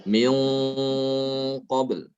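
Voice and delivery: "Min" held in a heavy nasal hum before "qabl"; thick ghunnah. Shown as a demonstration. Teacher explains qaf is heavy, thick nasal hum. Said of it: "maka gunanya ikut tebal".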